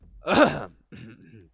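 bursting out laughing loud; a big loud laugh. Real, not acted. Streamer laughing at his own mistake, loud burst of laughter.

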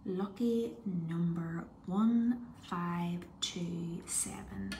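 slow hushed number reading; whisper. Real, not acted. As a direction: whisper each digit slowly. Suspense reading winning number.

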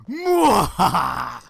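loud, slow evil cackle; slow theatrical villain menace. Acted. Villain laugh after mischief.

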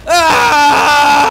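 high, very loud yell; startled scream. Real, not acted. Gamer jumped while trick-or-treating in game, startled scream. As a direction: scream out at a sudden attack.

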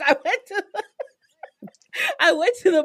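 cracking up mid-line, high-pitched; laughing. Real, not acted. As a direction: try to get the words out through real laughter. Storyteller cracks up mid-quote.

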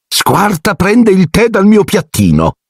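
high, slow, put-on aunt voice; smug high aunt voice; Aunt Marge. A narrator's reading. Marge answers about her dog.

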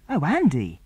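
a startled plea, rising high; girl pleading with her friend, mildly startled cry; cried out. Acted. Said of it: "cried Mary".

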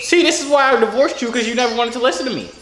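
high, fussy complaint; mock spousal quarrel. Divorced spouse to an ex who never listens, high mock grievance. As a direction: nag like a fed-up ex.

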